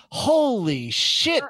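high, loud, slow drawn-out exclamation; Belushi impression. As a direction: stretch it like Belushi, hammed up. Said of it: "and then Belushi goes".

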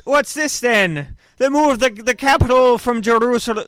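loud, high hammed-up Irish brogue; Irish accent. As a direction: play a blustering Irishman, way up high. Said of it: "I was gonna try to do an Irish accent".

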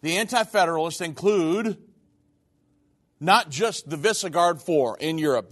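normal talking voice

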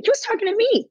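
high, incredulous emphasis; can you believe he meant me; indignant. Real, not acted. Indignant retelling of op-ed.

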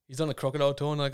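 usual speaking voice

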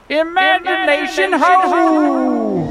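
drawn-out slow call; slow rallying cry. Acted. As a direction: stretch each word like a spell. Magic catchphrase call.

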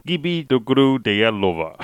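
slow, hammed-up Russian attempt; mock Russian. Shown as a demonstration. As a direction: stumble through it in Russian. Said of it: "我试着用俄文讲".

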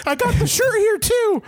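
high, lightly hammed advert voice; high ad pitchman voice. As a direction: pitch it like a cheesy ad. Mock ad pitch.

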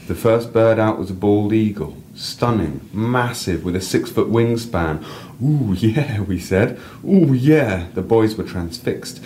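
reading a personal story aloud, lively. A narrator's reading. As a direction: read your piece to the listener with feeling. Reads own story aloud.

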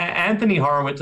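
ordinary talk, nothing marked

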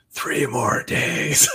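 slow, soft, sinister; menacing countdown, slow and quiet; ominous slow voice. Pretend. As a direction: say it like a creepy omen. Creepy reply to wrong numbers.

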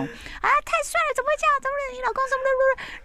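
squealing, swooning fangirl voice, very high; gushing Xiao S. Retold or imitated. Xiao S gushing on the phone about the narrator's husband, very high squeal. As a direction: gush over the husband like a lovestruck fan. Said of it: "就开始发那种春天啊".

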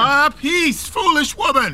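high, scornful rebuke; angry dismissal. Acted. Husband snapping at his pleading wife, high and harsh. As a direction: shut her up with contempt.

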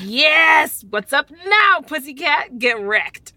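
loud triumphant yelling; gloating shout. Acted. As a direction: rub the win in loudly. Gloating win again, very loud.

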